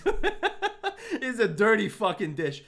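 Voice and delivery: laughing through the words, pitch jumping high; giggling at own dirty dish. Real, not acted. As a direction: crack up mid-sentence. Laughs while describing messy dish.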